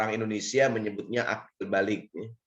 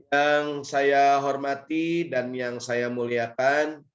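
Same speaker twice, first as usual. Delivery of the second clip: ordinary talk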